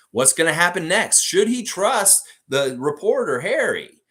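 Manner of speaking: milk the suspense like an episode's closing teaser; cliffhanger teaser. A narrator's reading. Teases the next story episode.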